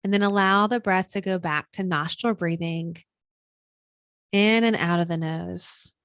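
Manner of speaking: unhurried, soothing breathwork guidance; guided meditation. Acted. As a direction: guide them gently into stillness, leaving room between phrases.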